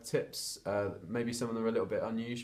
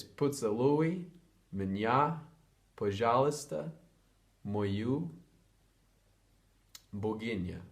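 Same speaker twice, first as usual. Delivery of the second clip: slow phrase demo; slower than usual. Shown as a demonstration. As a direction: carefully spell the phrase out for learners. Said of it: "So it's".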